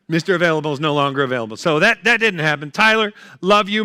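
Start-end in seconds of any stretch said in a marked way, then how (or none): 0.1-1.6 fast, rattled-off line; rushed punchline. Retold or imitated. Comedian rattling off the punchline fast. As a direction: fire it off quickly.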